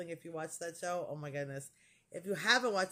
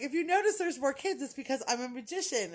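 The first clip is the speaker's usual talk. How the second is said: lightly voiced choir director, higher and louder, playful; joking choir director; 7 semitones higher, 4 dB louder, faster than usual. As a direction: toss off the magic joke like a showman. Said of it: "he's like".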